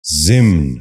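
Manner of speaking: ضمن in one syllable, "zimn"; correct pronunciation. Shown as a demonstration. Teacher giving viewers the model, correct pronunciation. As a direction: say ضمن as zimn, no second vowel. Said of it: "غور سے سنیے".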